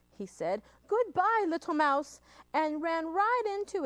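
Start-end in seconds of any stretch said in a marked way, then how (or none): 0.9-2.2 high, raised, hurried farewell; fleeing fox. Acted. Fox runs off from the Gruffalo, frightened. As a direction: call goodbye in mock fright.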